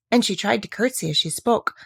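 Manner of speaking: usual speaking voice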